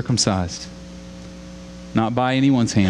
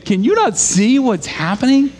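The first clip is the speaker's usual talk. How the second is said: high, louder desperate questioning; anguished plea pitched up and louder; David pleading with God; 9 semitones higher, 3 dB louder than usual. Retold or imitated. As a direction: press God for answers, straining high. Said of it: "David is saying".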